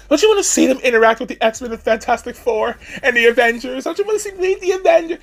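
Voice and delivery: pleading fan, climbing to a high squeal; mocking fanboy plea.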